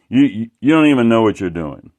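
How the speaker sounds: moderately loud imitated scolding, "don't" pushed; huffy artist telling him he's clueless; mock angry rant. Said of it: "It, like, he was pissed".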